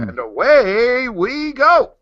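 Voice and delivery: drawn-out, high show-opening call; rallying kickoff. Real, not acted. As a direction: kick it off with long, lifted words. Host calls out start of show.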